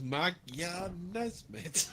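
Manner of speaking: slow, soft, lightly bumbling politician voice; drawn-out, hesitant mock statesman; Boris Johnson. Reading The Guardian, Boris Johnson mispronounces it, slow mock. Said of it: "so Boris Johnson says".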